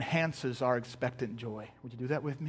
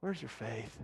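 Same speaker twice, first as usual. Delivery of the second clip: low, very quiet mock-weary rebuke; eye-rolling exasperation; 6 semitones lower, 12 dB quieter, slower than usual. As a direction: say it under your breath, with an eye roll. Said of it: "kinda rolls his eyes at him".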